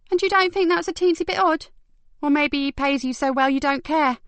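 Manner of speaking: high, mocking sarcasm; teasing disbelief; needling. Detective mocking the carer, sarcastic.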